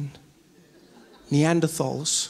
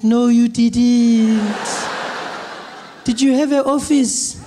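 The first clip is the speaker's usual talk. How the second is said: higher, loud, very slow granny; drawn-out scolding granny; grandmother voice; 5 semitones higher, 4 dB louder, slower than usual. Retold or imitated. Granny dismissing Trevor's job, high slow old voice.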